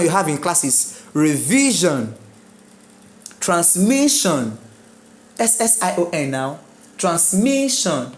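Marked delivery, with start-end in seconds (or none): 1.1-4.6 Revision and Transmission with VI and MI lengthened; stress on middle syllable. Shown as a demonstration. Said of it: "The stress must be second to last". 7.0-8.1 Transmission with MI raised and lengthened; stress on MI. Shown as a demonstration. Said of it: "The stress must be second to last".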